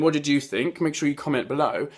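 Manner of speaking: ordinary talk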